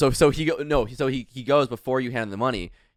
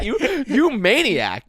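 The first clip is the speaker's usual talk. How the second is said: high, slow, through laughter; drawn-out laughing mock accusation; laughing disbelief; 11 semitones higher, 5 dB louder, much slower than usual. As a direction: crack up while exclaiming it. Laughing at friend keeping the ring.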